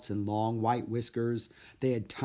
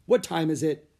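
loud worried question; anxious about the time; 6 semitones higher, 7 dB louder than usual. Acted. Jack alarmed.

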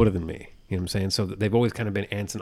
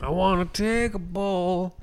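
high, slow mimicking echo; teasing high-pitched copy of his words; mocking echo; 10 semitones higher, slower than usual. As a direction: parrot him back in a silly high voice. Mocking co-host's line back.

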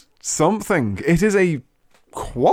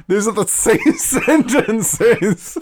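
laughing; 7 semitones higher, 3 dB louder, slower than usual. Real, not acted. Laughing at co-host.

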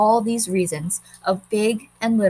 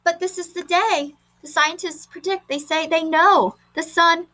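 pleading Margot; 8 semitones higher than usual. Acted.

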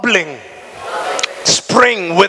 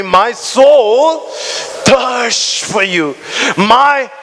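word by word, slow and pressing; emphatic reading; 3 semitones higher, slower than usual. Real, not acted. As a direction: space each word out. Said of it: "Read it with me".

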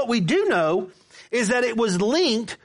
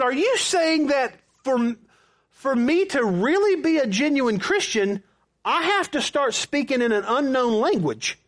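retold indignant disbelief; acted incredulity; 5 semitones higher than usual. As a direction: question it in disbelief, pitch raised. Retold incredulous challenge to the pastor.

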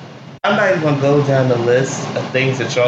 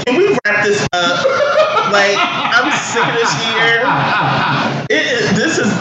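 laughing through the words, raised higher; 7 semitones higher than usual. Real, not acted. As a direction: laugh it out, exasperated. Laughing about the awful year.

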